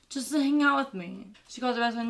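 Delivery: usual speaking voice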